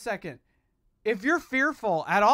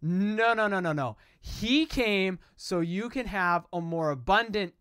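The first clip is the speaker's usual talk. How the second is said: ordinary talk, nothing marked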